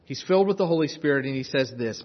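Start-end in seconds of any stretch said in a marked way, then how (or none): none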